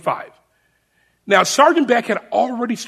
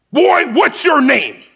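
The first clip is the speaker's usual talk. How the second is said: higher, slow and louder in his face; imitated drill sergeant; 6 semitones higher, 4 dB louder, slower than usual. Sergeant in the recruit's face, imitated drill bark. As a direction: loom over the recruit and demand it. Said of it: "gets right in my face".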